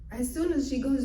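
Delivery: ordinary delivery, nothing marked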